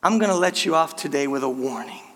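officer letting you off, low and soft. Retold or imitated. Cop at the car window, low and calm. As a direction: hand down the warning quietly. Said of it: "and he says".

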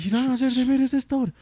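high, questioning dad imitation; mimicking a dad who doubts the story; incredulous father. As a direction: query them in a high, disbelieving pitch.

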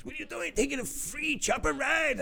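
high mock scolding boss. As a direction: jab at him in a high nagging voice. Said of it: "He says".

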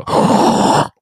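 loud demonstrated gasp through the mouth; mouth-breathing gasp. Shown as a demonstration. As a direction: suck air in noisily through an open mouth. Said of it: "so I have to go".

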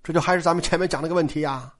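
ordinary delivery

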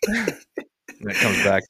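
ordinary talk, nothing marked

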